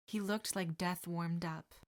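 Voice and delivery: ordinary delivery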